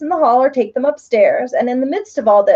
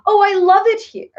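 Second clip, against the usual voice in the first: joyful cry; 9 semitones higher than usual. A narrator's reading. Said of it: "Betsy cried out".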